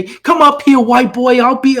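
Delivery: loud, high mock shout of Tyson on stage; imitating Tyson. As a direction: bellow the challenge up high, hammed-up. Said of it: "I've seen Mike Tyson ... say".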